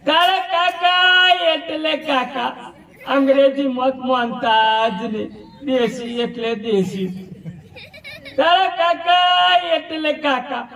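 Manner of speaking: very high, slow, wailing widow's lament; mock crying widow. The widow laments her husband to the village, in a high, slow, mock wail. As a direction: wail the praise like a hammed-up grieving widow. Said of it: "એ રડતા રડતા કહેતા".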